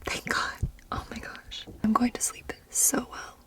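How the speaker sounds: very soft, airy whisper. Real, not acted. Camper relieved there are no bears, whispering. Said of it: "I'm whispering because it's pretty full".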